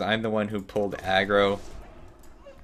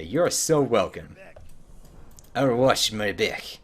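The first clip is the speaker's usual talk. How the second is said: ordinary talk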